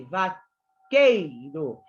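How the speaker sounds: "vaqueiro" slowly with the stress on "quei"; stressed syllable. Shown as a demonstration. Teacher stresses the last strong syllable of "vaqueiro". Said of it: "Vai até a sílaba mais forte".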